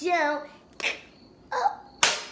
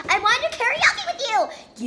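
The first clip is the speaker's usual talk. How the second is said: excited plea; 8 semitones higher, 6 dB louder than usual. Acted. Character pleading excitedly.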